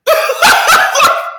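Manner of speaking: shrieking burst of laughter; high loud laugh. Real, not acted. Own laughter at the scene.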